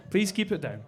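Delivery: ordinary talk, nothing marked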